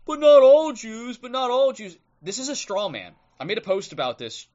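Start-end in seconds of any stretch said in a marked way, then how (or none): 0.0-2.0 mocking objector. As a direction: parrot the objection in a shrill put-on voice. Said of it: "you get people going".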